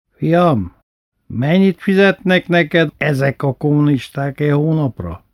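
primás asking father his monthly salary; scornful bandleader. Retold or imitated. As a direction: sneer at the communists' pay. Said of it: "primás asks about salary".

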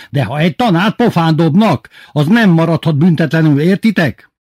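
high, loud warning; threatening teacher. A narrator's reading. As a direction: drive the warning home. (fenyegető, emelt hang)

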